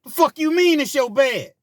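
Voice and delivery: high, loud angry yell; enraged boyfriend shouting; angry demand. Retold or imitated. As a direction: confront him, way up high. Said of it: "angry fiance confronts friend".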